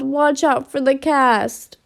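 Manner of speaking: plain reading voice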